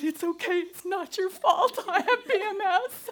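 high, loud, half-laughing half-crying apology; to the upset grocery lady, laughing through sobs; retold sobbing. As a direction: blurt it out through tears and laughter. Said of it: "and I start sobbing".

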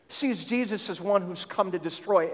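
ordinary talk, nothing marked

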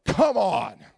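loud, high frustrated yell; exasperated shout. Retold or imitated. Fan turns to find the heckler is a kid and yells in frustration. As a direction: cry out in disbelief at your bad luck. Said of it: "I'm like".

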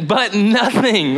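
mock sulky objection; drawn-out "but nothing"; slow, drawn out. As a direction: stretch it out slowly. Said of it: "And I was like".

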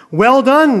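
a king's hearty, loud praise; exclaimed. A narrator's reading. King praising his faithful servant with a loud, high exclamation. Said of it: "the king exclaimed".

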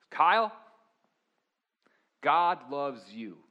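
loud and slow, each word pressed hard; a coach drives it home with force; intense coach voice. Retold or imitated. Coach pulls the player aside after practice, intense and loud. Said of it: "kind of an intense voice".

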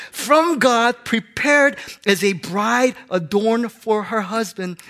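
preaching. Acted. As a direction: proclaim the verse with force. Preacher reading scripture at full force.

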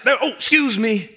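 slow, high, prim little apology; mock polite apology. Someone whose foot was stepped on, apologizing in mock politeness. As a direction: act out a sweetly polite "excuse me".

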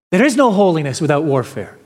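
fast, brisk repeat of the line; quick restatement. Shown as a demonstration. Preacher repeating the quote to the congregation, quick and brisk. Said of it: "he adds the following".

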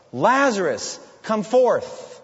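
high, forceful command; loud calling out. Retold or imitated. Jesus calls Lazarus out of the tomb, in a loud voice. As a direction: call out loudly to raise the dead. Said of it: "call out in a loud voice".

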